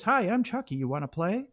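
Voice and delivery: high, sing-song talking-doll greeting. Retold or imitated. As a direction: chirp it like a toy. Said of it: "you hear that voice".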